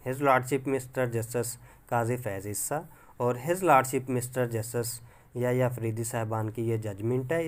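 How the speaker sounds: normal talking voice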